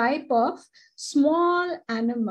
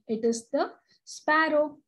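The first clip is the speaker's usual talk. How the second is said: ordinary delivery, nothing marked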